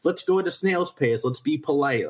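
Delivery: high, sing-song mockery of politeness; mocking. Guest mocking Southern coworkers, in a high mocking voice.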